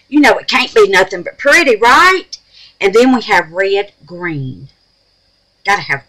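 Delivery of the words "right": high, loud rising right; excited question. Real, not acted. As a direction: toss it to the viewers eagerly. Asking viewers after whisper.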